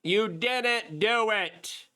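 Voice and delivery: high, slow drawn-out accusation; mock accusing. Host voicing Rob Dew scolding Bongino, high and slow. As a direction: stretch each word out in disbelief. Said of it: "Rob Dew is like".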